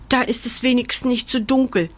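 plain reading voice

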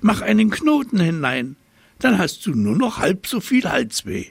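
high, lively monkey voice; chattering monkey. A narrator's reading. Monkey giving the giraffe cheeky advice, high chatter.

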